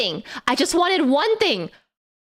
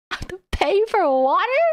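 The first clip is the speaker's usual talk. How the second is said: incredulous; 6 semitones higher, faster than usual. Real, not acted. Outraged at paying for water.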